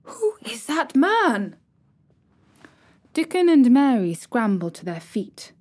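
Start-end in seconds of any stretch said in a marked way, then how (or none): 0.0-1.6 slow, breathy, alarmed whisper. A narrator's reading. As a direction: hiss the question in sudden alarm. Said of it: "exclaimed in a loud, suddenly alarmed whisper".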